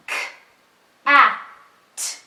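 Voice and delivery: each sound said alone with long gaps; choppy separate sounds. Shown as a demonstration. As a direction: pause between every sound, the wrong way. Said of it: "like this".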